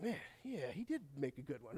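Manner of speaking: hushed, smug murmur to oneself; mock self-admiration. Preacher plays someone admiring themselves in the mirror, mock self-admiring. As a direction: drop very soft, as if privately impressed with yourself.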